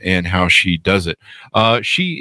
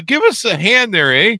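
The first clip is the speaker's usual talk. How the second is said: mock Canadian accent, much higher pitch; 11 semitones higher than usual. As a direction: toss in a jokey Canadian eh. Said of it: "I went all Canadian with the A A".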